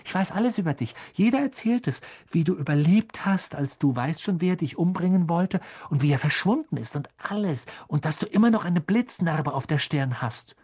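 put-on high-pitched, gushing young admirer; excited fanboy chatter; eager young fan. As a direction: gush it all out in a high voice.

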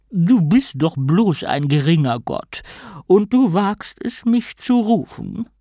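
slow, scornful sea queen; disdainful queen of the sea, slowly; evil queen. A narrator's reading.

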